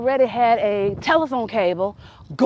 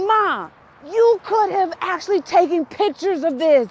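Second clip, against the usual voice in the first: high-pitched exasperated complaint; retold outrage, pitched way up; exasperated protest; 8 semitones higher than usual. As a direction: plead with mom in comic disbelief. Acted protest to mom on phone.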